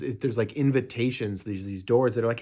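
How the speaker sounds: normal talking voice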